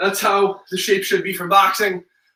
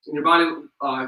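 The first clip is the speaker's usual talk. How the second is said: ordinary delivery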